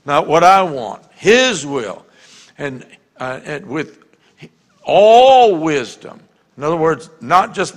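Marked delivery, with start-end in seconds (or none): 1.1-2.0 "his" long and loud; forceful, drawn-out "his"; emphasis on HIS. Shown as a demonstration. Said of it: "I'm gonna put emphasis on". 4.8-6.2 "all" very long, high and loud; booming, stretched-out "all"; emphasis on ALL. Shown as a demonstration. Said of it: "I'm gonna put emphasis on".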